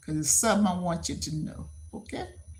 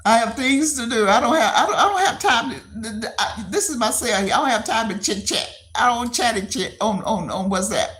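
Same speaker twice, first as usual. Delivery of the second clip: loud, higher-pitched, insistent protest; loud rebuff; 5 semitones higher, 6 dB louder than usual. Real, not acted. Streamer refusing to chit chat, loud insistent rebuff. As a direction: push back hard, raising your voice.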